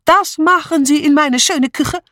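put-on very high, indignant foreign-accented tirade; fly at her, stretching the accusing words; angry outburst. A narrator's reading. Mitzi attacking Julia over her kitchen, furious outburst. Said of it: "fuhr sie los".

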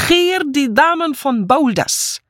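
high, slow announcement; trumpeting. A narrator's reading. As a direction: trumpet it out like a herald.

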